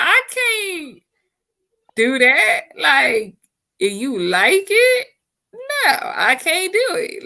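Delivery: high, pleading mock refusal; whiny high refusal. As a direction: squeak out the refusal, pitch way up. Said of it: "I'm like".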